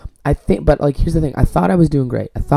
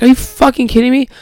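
higher and louder, disbelieving snap; exasperated outburst; 11 semitones higher, 5 dB louder than usual. Retold or imitated. Vlogger retelling his reaction to the servers, exasperated. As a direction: blurt it in outraged disbelief.

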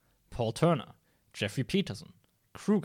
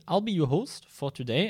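ordinary talk

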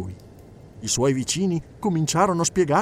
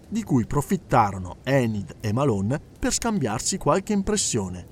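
usual speaking voice

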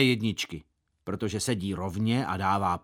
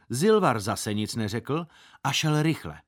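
plain reading voice

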